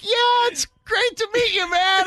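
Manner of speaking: very high, overeager greeting; embarrassed friendliness. Retold or imitated. Caught-out host greeting a listener, embarrassed. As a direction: squeeze out a mock-cheerful hello, slow and high.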